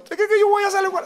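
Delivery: put-on very high-pitched tearful child protest; hammed-up wail of a mortified kid; mock crying. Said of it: "Y yo, yo llorando".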